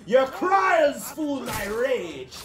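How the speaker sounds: slow, drawn-out high taunt; hammed-up villain line, very slow; mock villain boast. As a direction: ham it up like an anime villain. Taunting enemies in fight.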